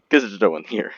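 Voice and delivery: ordinary talk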